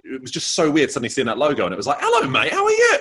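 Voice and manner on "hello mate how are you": cheery greeting. Pretend. Shop logo greeting him like friend.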